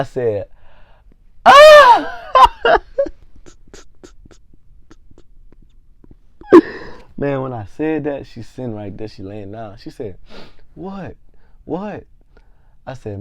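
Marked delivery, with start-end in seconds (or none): 1.5-2.2 loud, very high reenacted scream; mock shocked scream. Storyteller acting out discovering he pissed himself, mock scream. Acts out his shocked cry. 2.2-7.1 high, loud burst trailing into wheezes; helpless laughter. Real, not acted. Storyteller cracking up after his own scream, helpless laughter. 10.7-12.1 soft, high-pitched questioning voice; imitated puzzled girlfriend. Girlfriend lying in bed asked by storyteller, imitated puzzled voice.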